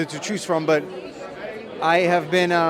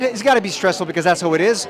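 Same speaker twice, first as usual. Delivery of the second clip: usual speaking voice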